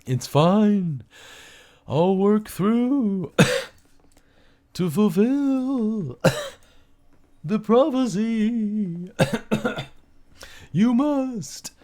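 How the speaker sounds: high ghost voice, very slow, broken by coughs; a spirit forcing the prophecy out through a scratchy throat; coughing spirit. Acted.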